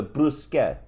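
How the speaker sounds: "bruschetta" clipped Italian-American style, final vowel dropped; imitating a customer's fake Italian accent; put-on Italian accent. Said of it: "throw on an Italian accent".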